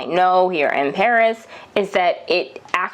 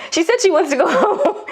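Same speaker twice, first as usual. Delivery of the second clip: high, quick, laughing mock reply; laughing explanation; 10 semitones higher, faster than usual. A third nurse explains the patient's words, quick and laughing. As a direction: rattle it off, breaking into laughter.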